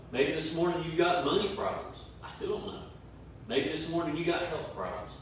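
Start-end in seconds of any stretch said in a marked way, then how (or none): 2.2-2.8 a teasing whispered aside to the viewers. Real, not acted. Preacher teasing online viewers about money problems, whispering. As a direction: whisper it, playful and unvoiced.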